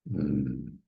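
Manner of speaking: ordinary delivery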